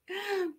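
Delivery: quick breathy giggle; a little laugh at the chat; high laugh. Real, not acted. Seller laughing at viewers naming the design.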